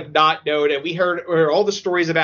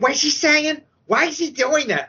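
lightly voiced older moviegoer, pitched up; higher, questioning mock of a talkative audience member; chattering older couple; 9 semitones higher than usual. Older couple talking through the film, higher querulous questions. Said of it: "like, this older couple".

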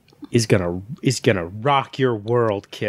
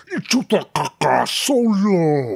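Jabba voice; 4 semitones higher, slower than usual. Retold or imitated. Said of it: "he'll go like".

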